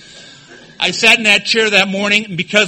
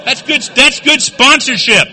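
ordinary talk, nothing marked

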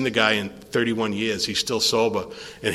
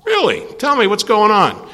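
high-pitched surprised "really", then prompting; retold surprised reply; 8 semitones higher, 4 dB louder than usual. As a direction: act out a startled hotline volunteer. Acting his hotline reply to caller.

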